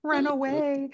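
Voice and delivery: squeaky run-away line; mock scared dog. Voicing the dog.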